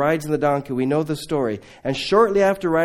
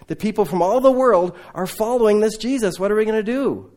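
panicked Pharisees; 7 semitones higher, 5 dB louder than usual. Retold or imitated. Pharisees panicking, continued quote.

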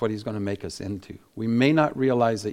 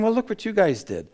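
much higher, bright and generous; eager praise; 10 semitones higher than usual. Retold or imitated. Gideon praising the Ephraimites, higher and eager. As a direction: lift it up as if handing them all the credit. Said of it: "He's saying".

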